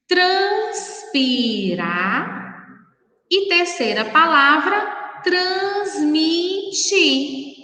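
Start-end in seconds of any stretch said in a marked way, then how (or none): 0.1-2.4 "Transpirar" drawn out in separate syllables. Shown as a demonstration. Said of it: "Três sílabas". 5.2-7.6 "transmitir" very slow, each syllable held. Shown as a demonstration. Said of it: "na separação de sílabas".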